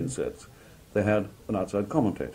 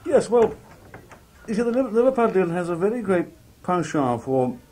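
high, loud put-on Scouse; Scouse accent; 12 semitones higher, 6 dB louder than usual. Shown as a demonstration. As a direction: pitch it right up into Liverpool Scouse. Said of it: "I lapse into Scouse".

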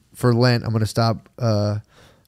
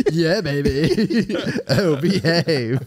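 slow, laughing British swinger drawl; mock British seducer, slowed right down; Austin Powers British; 2 semitones higher, much slower than usual. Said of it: "they just turn into Austin Powers".

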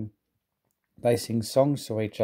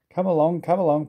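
higher, louder hurried puffing; 6 semitones higher, 4 dB louder than usual. A narrator's reading. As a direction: puff it out urging the coaches on. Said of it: "puffed Thomas".

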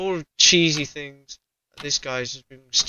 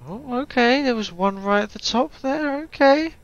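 startled; 9 semitones higher than usual. Real, not acted. Startled by TV on screen.

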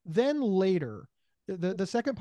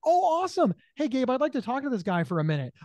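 bright excitement; 6 semitones higher, 3 dB louder than usual. Retold or imitated. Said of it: "be like".

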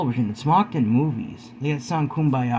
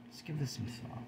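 much softer, almost under the breath; hushed repeat; 18 dB quieter than usual. Shown as a demonstration. Vlogger urging viewers to reflect, hushed and soft. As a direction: let the repeat sink in quietly.

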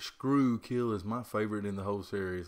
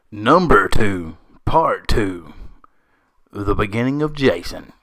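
announcer voice; 19 dB louder than usual. Acted. Announcing countdown entry.

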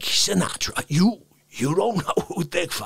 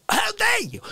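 high-pitched indignant yelp; 13 semitones higher than usual. Acted. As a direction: shriek it like outraged diva. Catchphrase high.

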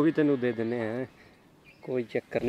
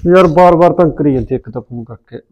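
loud irritated grumble to himself; fed-up grumble; 13 dB louder than usual. Acted. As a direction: complain loudly before answering the phone. Fed up with repeated calls. (ਖਿਝ ਕੇ ਉੱਚੀ ਬੁੜਬੁੜ)

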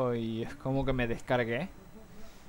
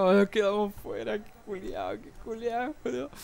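mock panicked cursing; 7 semitones higher than usual. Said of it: "estaba como loco diciendo".

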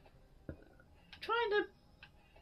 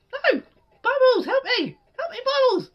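desperate shout; 4 semitones higher, 6 dB louder than usual. Real, not acted. Calling pet for help.

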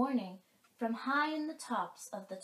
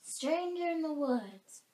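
high, loud alarmed forest call; animal calling out; 5 semitones higher, 6 dB louder than usual. Acted. A forest creature asking who is in the woods, loud and high.

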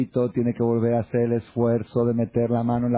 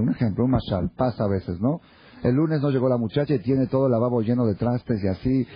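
ordinary delivery

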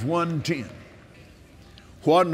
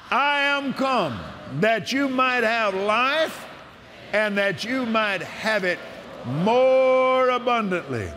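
slow, higher, ringing proclamation of the verse; solemn preacher's proclamation; preaching scripture; 6 semitones higher than usual. Acted. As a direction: declare it as the voice of God himself, slowly.

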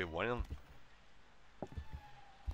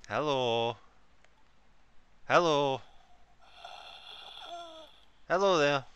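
loud, higher-pitched hellos; calling out; 6 semitones higher, 7 dB louder, slower than usual. Real, not acted. Player trying to talk to the baby, calling out. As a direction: call out hopefully, each one higher.

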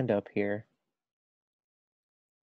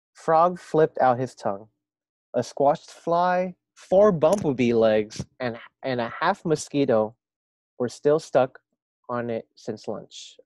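lively storybook reading voice; reading to children; 3 semitones higher, 9 dB louder, slower than usual. A narrator's reading. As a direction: read it aloud to a class. Reads story aloud to first graders.

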